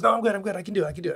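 imitating the young actor insisting he's ready; eager actor. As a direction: play the keen actor saying he's fine. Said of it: "he goes".

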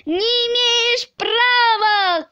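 high, drawn-out cry of protest; indignant shout. A narrator's reading. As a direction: shout it out in protest, stretching the vowels. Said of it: "кричал". (возмущённый крик)

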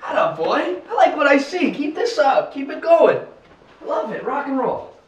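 loud, high-pitched hype; excited cheering. Acted. Director egging on his crew, excited cheering. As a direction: whoop them on with gusto.

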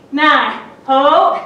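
normal talking voice

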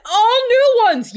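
high, slow, loud line shouted with the film; thrilled shout at the screen; excited shout. Real, not acted. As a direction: belt it out with the character. Shouting along with the movie.

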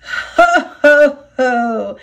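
amused "ho, ho, ho"; loud acted chuckle, falling away; chuckling. A narrator's reading. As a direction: chuckle at a rival's misfortune. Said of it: "chuckled Duck".

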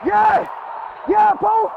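loud high celebratory yell; excited cheer. Real, not acted. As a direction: cheer the play as it happens. Cheering a big play.

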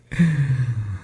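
light chuckle tailing into breath; soft, silly laugh; amused giggle. Acted. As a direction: giggle at your own joke. Amused by own pun.

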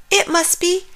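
high, amazed realization; thrilled exclamation. A narrator's reading. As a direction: exclaim it high with dawning excitement. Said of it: "Bear exclaimed".

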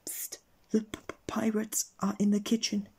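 high stammering whisper. Acted. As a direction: whisper it, scared and stuttering. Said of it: "whispered Mister Jelly".

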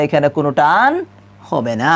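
ordinary delivery

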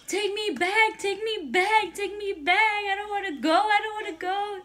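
high, mock-tearful begging; retold crying plea. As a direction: imitate a hysterical kid pleading over and over. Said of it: "like, crying".

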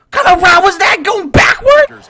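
loud, high, disbelieving shout; outraged yell. Real, not acted. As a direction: yell at the receiver's dumb route. Outraged at teammate's wrong route.

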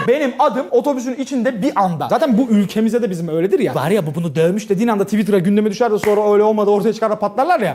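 ordinary talk, nothing marked

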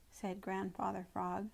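ordinary delivery

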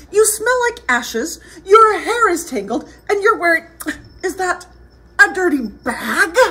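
high-pitched fussy prince; disdainful prince. Acted. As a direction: scold her with haughty disgust. Voices Prince Ronald scolding Elizabeth.

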